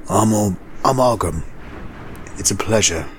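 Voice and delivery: lightly voiced shifty stranger; a smooth, sly introduction; introducing himself. Shifty stranger Ogum introduces himself, lightly sly voice. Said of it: "introduced himself".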